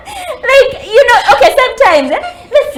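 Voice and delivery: laughing. Real, not acted. High after laughter.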